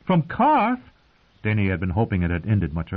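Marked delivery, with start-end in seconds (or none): 0.1-0.8 shocked question. Acted. Queen hearing her knight's confession, shocked. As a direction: leap high in disbelief. Queen hears unwelcome news.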